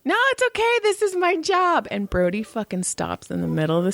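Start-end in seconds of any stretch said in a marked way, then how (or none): 0.0-1.9 high, goofy crossing guard. Retold or imitated. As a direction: pitch way up for the guard. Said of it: "the crossing guard dude goes".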